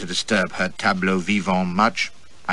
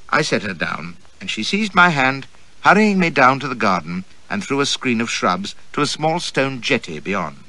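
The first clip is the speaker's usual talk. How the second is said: usual speaking voice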